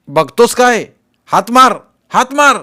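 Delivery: high, loud urging; urgent high-pitched command; urgent shouting. A narrator's reading. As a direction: yell instructions to a floundering swimmer. Urgent call to a struggling swimmer.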